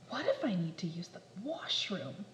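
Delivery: soft whisper; hushed anxious aside; whispered worry. Acted. Worried whisper.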